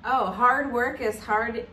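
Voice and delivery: ordinary delivery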